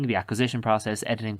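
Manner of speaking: ordinary talk, nothing marked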